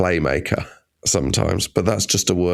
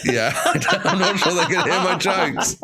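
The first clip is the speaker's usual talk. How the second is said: higher, laughing through the words; 8 semitones higher than usual. Real, not acted. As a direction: laugh at your own failed gigs as you speak. Laughs at her own bad gigs.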